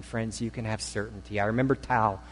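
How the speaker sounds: usual speaking voice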